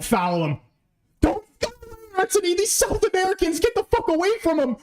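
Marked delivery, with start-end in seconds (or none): 1.2-4.8 high panicked warning. Real, not acted. Defender closing in.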